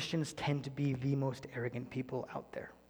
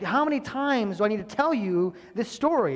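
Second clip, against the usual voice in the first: exasperated; 7 semitones higher, 7 dB louder than usual. Retold or imitated. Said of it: "He's like".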